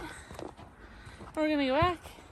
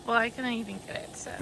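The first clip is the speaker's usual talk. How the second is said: normal talking voice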